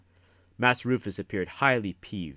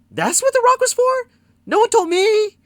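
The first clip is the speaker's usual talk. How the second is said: much higher, louder squawk of disbelief; flummoxed protest; 17 dB louder than usual. A narrator's reading. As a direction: protest that nobody told you, flustered. Jasper flummoxed, protesting ignorance.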